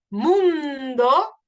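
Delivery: MUNDO with a long, loud U; stressed vowel demo. Shown as a demonstration. Teacher showing where mundo rhymes, U stressed and stretched. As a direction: lean hard on the U in MUNDO. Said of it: "U en la U".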